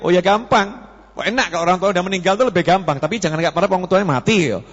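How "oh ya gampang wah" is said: ordinary talk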